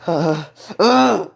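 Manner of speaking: gasping, then a loud high groan; strained groans. Acted. Crowd pinned under immense pressure, strained groans. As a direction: groan as if being crushed.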